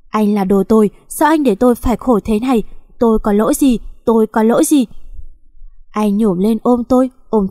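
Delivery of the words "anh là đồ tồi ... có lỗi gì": usual speaking voice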